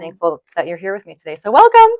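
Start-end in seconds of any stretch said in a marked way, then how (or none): 1.5-1.9 high, loud, beaming "welcome"; gushing welcome to her guest; excited welcome. Real, not acted. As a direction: throw the welcome out with joy. Warm big welcome to guest.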